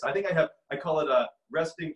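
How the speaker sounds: ordinary delivery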